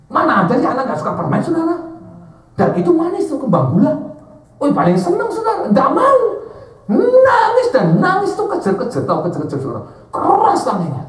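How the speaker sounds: loud, high sermon banter; lively preaching. Acted. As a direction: preach with a grin to the crowd.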